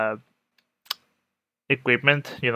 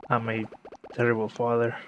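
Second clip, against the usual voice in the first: normal talking voice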